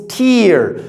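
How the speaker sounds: drawn-out "tear" rhyming with ear; noun pronunciation of tear. Shown as a demonstration. Teacher introducing eye drops word, "tear" said slowly as teer. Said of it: "As a noun".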